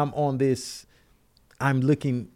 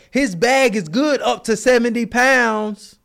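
high, loud, slow mock clerk voice; hammed-up airline clerk drawling it out; imitating the desk lady; 9 semitones higher, 5 dB louder than usual. Mocking the desk lady yelling from down the hall, high and loud. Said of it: "She was like".

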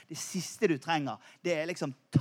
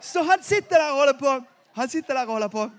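excited; 6 semitones higher, 8 dB louder than usual. Real, not acted. Excited retelling of accident.

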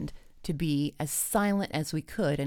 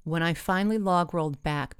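ordinary delivery

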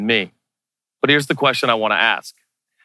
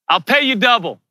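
higher-pitched eager offer; calling up; 8 semitones higher than usual. Acted. Akiva making the guard an offer, higher call. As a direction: make the offer high, calling upward.

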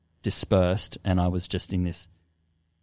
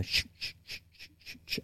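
unvoiced rattling sh sounds; whispered imitation of a ceremonial rattle; imitated shaker rattle; 13 dB quieter, faster than usual. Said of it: "I would hear the rattle".

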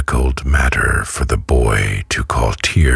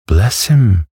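gushing nurse voice; 10 semitones higher than usual. A narrator's reading. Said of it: "interposed Missus Mann".

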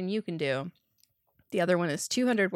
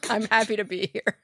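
laughing; 5 semitones higher, 4 dB louder than usual. Real, not acted. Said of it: "my allergies are causing my eyes to water".

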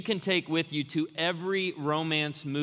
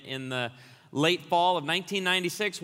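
usual speaking voice

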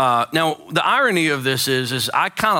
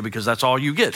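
usual speaking voice

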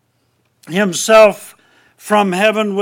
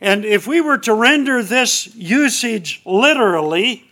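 ordinary talk, nothing marked

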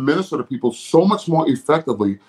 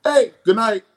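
high hyped stage shout; showy concert performer; 12 semitones higher, 3 dB quieter than usual. Retold or imitated. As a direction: hype up the crowd like a star. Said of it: "they're like".

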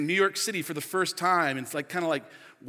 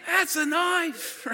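high, very drawn-out Australian bushman imitation; playful Aussie hero's boast; Nick Dundee; 12 semitones higher, 3 dB louder, much slower than usual. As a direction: stretch the catchphrase out proudly. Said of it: "And he's like".

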